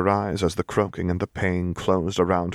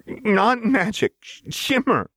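high, slow, bitten-off correction; spat; slower than usual. Acted. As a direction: spit the word shimmer out. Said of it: "Jinx spat".

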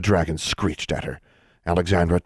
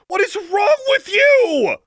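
high, slow shrieking shout; screaming; 6 dB louder, slower than usual. Acted. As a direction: shout at them in disbelief. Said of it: "Alexandra screamed".

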